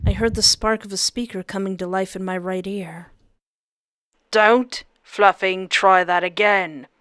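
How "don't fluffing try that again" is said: slow, loud warning; booming threat. Acted. Captor warning through the helmet speaker, booming. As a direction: loom over them with the threat. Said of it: "His voice suddenly booming".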